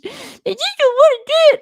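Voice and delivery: high, loud whiny mock voice; mock whinge. Imitating herself whinging to her husband: high whiny mock voice. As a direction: whine it like a sulky child. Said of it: "Whenever I'm having a whinge".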